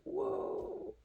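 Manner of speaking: high-pitched whoa without the shout; lighter, high mock-startled whoa. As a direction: replay the startle high, at everyday volume. Retells the same startled reaction, quieter.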